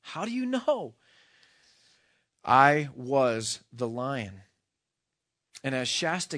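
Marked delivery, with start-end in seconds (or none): 0.0-0.9 high-pitched startled question; a boy's incredulous question, much higher; startled challenge. Acted. Boy challenges the lion. 2.4-4.4 very slow, loud, weighty lion; grave, spaced-out declaration; solemn revelation. Acted. Lion reveals himself to the boy.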